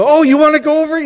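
high, loud excited question; eager surprise. Retold or imitated. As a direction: imitate a husband jumping at the offer. Retold husband jumping at the offer.

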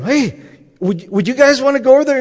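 high, rushed excited question; eager invitation. Retold or imitated. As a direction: imitate an eager man rallying his friends. Retold man eagerly inviting class.

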